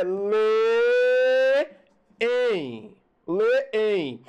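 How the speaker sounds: leem drawn out as lê and em, then joined as leem; spelled-out leem. Shown as a demonstration. Teacher spelling out the old form leem. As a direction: pull leem apart into lê, em, then put it together. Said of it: "Antigamente escrevia".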